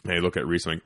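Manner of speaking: ordinary talk, nothing marked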